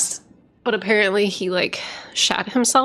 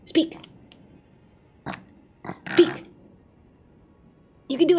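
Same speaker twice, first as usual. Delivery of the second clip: high doting dog voice; 5 semitones higher, 5 dB quieter than usual. Real, not acted. Talking to her dog.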